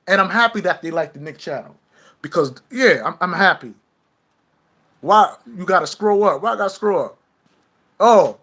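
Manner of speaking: normal talking voice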